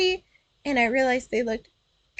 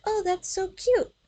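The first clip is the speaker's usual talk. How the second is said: put-on little girl voice; 7 semitones higher than usual. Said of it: "And she was like".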